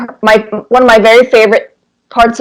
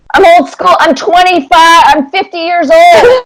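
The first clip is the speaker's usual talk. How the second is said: laughing self-mockery; 9 semitones higher than usual. Real, not acted. Guest jokes about her age.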